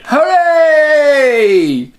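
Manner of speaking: joyful cheer. Acted. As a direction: cheer like a kid who made it home. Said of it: "Danny cried".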